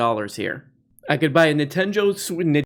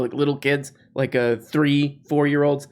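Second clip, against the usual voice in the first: normal talking voice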